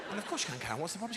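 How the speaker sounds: ordinary talk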